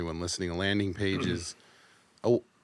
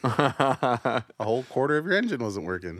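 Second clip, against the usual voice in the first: lightly voiced dad, higher and louder; mock dad voice; 4 semitones higher, 4 dB louder than usual. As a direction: imitate dad's deadpan remark. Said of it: "that's an astute comment".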